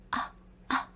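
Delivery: breathy retold cries of pain, barely voiced; whispered pain moans. During a painful massage, a mild whispered pain moan. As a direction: let the ahs of pain slip out in a whisper. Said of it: "진짜 아프다는 비명소리가".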